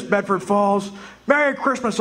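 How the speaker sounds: ordinary delivery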